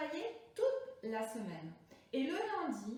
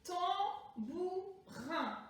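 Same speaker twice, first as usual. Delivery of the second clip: "Tambourin" very slow in three separate syllables, higher; découpage syllabique; 4 semitones higher, much slower than usual. Shown as a demonstration. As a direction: call out "Tambourin" one syllable at a time. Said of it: "Combien de syllabes?". (« Tambourin » très lent, en trois syllabes)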